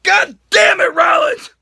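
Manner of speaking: re-enacted angry shout; exasperated outburst. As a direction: yell it like a furious fan. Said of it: "And I'm like".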